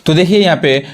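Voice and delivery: ordinary delivery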